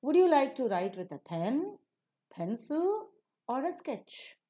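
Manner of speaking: PEN and PENCIL rising high, SKETCH falling; rising tone on alternatives. Shown as a demonstration. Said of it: "For sketch, we fall down".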